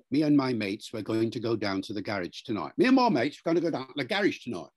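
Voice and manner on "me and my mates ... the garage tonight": traditional Cockney. Shown as a demonstration. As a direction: rattle it off in broad Cockney. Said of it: "a Cockney from London".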